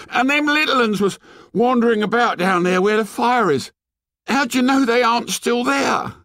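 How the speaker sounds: put-on very high, outraged boy; angry accusation. A narrator's reading. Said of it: "Piggy lost his temper".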